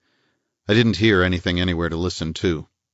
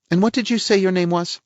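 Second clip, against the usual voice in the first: a higher-voiced prim society lady; lady's voice, pitched up and a little pert; voiced woman; 12 semitones higher than usual. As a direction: play the indignant lady of the house. Woman figure's lines.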